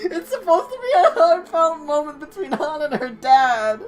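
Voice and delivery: loud, high, laughing through words; talking through a laughing fit. Real, not acted. Protesting while laughing.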